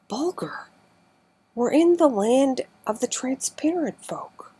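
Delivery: high, loud cry of delight; joyous exclamation. A narrator's reading. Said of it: "I exclaimed joyously".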